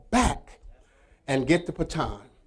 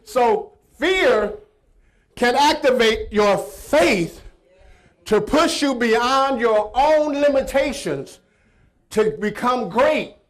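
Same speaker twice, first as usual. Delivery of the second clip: preaching at full force; 5 semitones higher, 6 dB louder than usual. Acted. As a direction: proclaim the moral from the pulpit. Preaching the moral.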